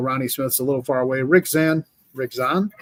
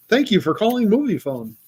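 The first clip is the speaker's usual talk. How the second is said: Movie Phone voice; 5 semitones higher than usual. Retold or imitated. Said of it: "That's my commercial voice".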